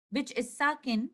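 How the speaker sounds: ordinary talk, nothing marked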